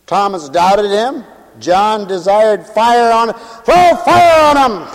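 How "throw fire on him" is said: high, raised mock command; hammed-up vengeful cry; fiery demand. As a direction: call down fire on someone. Acts John desiring fire.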